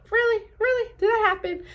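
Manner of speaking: high, doubting "Really? Really?"; mock disbelief.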